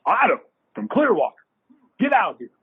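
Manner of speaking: high-pitched, retold phone order; demanding client. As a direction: imitate an impatient client summoning his agent. Gruff client orders him over by phone.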